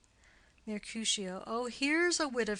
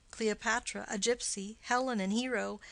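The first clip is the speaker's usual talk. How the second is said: usual speaking voice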